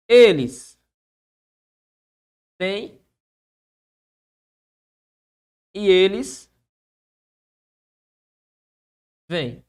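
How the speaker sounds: Eles, têm, e eles, vêm said with long pauses between them; slow dictation. Shown as a demonstration. Teacher dictating two sentences to students, slow with pauses. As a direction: set out each word of Eles têm e eles vêm slowly, one at a time. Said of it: "Deixe-me falar aqui a você duas frases".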